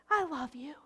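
soft whispered I love you; a tender whispered I love you. Shown as a demonstration. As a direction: breathe it gently, almost no voice.

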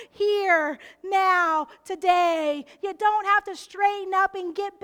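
loud, insistent sermon build; preaching climax. Acted. Pastor pressing the point to the congregation, loud. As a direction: drive it home to the church.

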